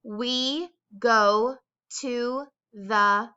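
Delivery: slow, clear dictation of a sentence; dictation read. Shown as a demonstration. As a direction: read it out slowly for children to write. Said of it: "Here is the sentence that you're going to write".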